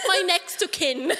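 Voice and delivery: hammed-up British formality; British accent. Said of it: "pero British accent siya".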